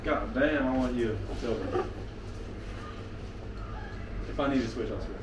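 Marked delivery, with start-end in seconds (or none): none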